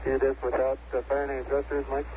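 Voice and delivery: crackly radio transmission; astronaut radio. Real, not acted. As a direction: voice over a distant radio link. Astronaut over radio link.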